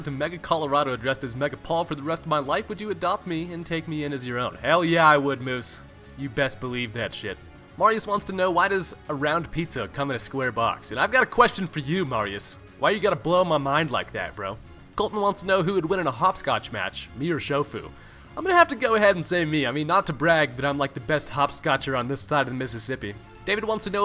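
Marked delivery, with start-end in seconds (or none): none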